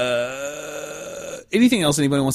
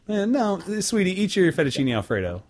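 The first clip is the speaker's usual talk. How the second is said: mock dismissive mom; 8 semitones higher than usual. Voicing his mom dismissively.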